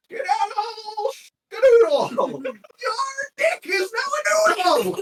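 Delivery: very high, loud shouted incantation; shouted spell. Acted. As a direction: yell the silly curse at him. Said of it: "and he goes".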